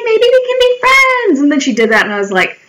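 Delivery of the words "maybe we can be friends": mock sweet offer.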